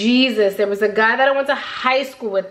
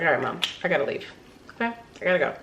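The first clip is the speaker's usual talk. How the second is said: rushed; 6 semitones lower, 7 dB quieter, much faster than usual. Retold or imitated. Hurrying teen leaving mom.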